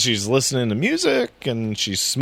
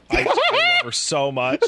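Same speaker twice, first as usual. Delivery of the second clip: higher, breaking into laughter at the end; laughing gush; 3 dB louder than usual. Real, not acted. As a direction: gush about her, then crack up laughing. Gushing over his game wife.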